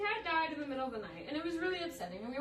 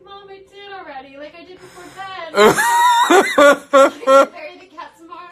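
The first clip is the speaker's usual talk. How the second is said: protesting daughter; 5 semitones higher, 20 dB louder, slower than usual. Retold or imitated. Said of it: "And I was like".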